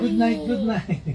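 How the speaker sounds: high sing-song good night. Real, not acted. Mother saying good night to her toddler, high coo. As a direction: coo it up high to a little one.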